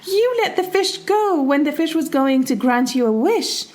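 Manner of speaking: high-pitched, incredulous scolding; indignant wife. Acted. Wife scolding her husband for freeing the fish, acted indignation. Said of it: "and his wife is like".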